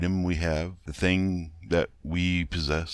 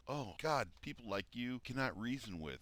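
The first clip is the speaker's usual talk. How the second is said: ordinary talk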